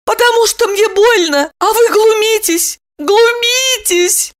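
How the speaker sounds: very high, squealing accusation; squeaky tearful reproach; hurt woman. A narrator's reading. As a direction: wail it, stretching the last word.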